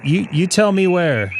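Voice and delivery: usual speaking voice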